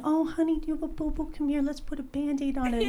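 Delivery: high, soft, cooing mock-mommy voice; nurturing mom. TV-commercial mom fussing over her hurt kid, mock nurturing coo. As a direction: coo sweetly over a child's scrape, hammed up. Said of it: "I would not be the mom going".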